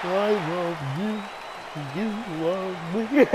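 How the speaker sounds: slow, drawn-out kiddie-show character voice; slow singsong mascot impression; Barney. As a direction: croon it slowly like a children's mascot. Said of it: "Anyway, I can do Barney".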